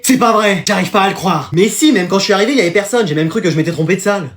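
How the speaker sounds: normal talking voice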